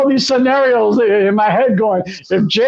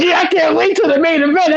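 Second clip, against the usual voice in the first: higher, eager recreated hype; retold amped-up excitement; 7 semitones higher than usual. Fan retelling the wait for the main event, amped up. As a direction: build up your anticipation. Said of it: "I'm getting all amped up".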